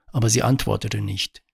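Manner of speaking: usual speaking voice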